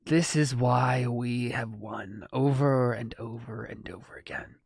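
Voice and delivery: slow and sinking to a whisper; slow villain voice fading to a whisper; villain monologue. Acted. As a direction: let the villain's line fade to a hiss of a whisper.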